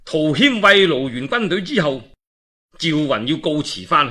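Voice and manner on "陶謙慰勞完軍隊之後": usual speaking voice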